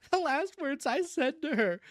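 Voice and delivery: high, plaintive cousin voice; pathetic. A narrator's reading. Cousin telling family her last words, pathetic. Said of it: "said Cousin Stickles pathetically".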